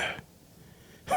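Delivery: unvoiced groan of reluctance; whispered ugh. Posting videos he hates, a whispered groan. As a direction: breathe out a soft disgusted ugh. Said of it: "I be like".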